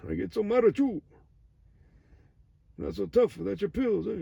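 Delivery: hammed-up taunting cartoon voice; cartoon villain.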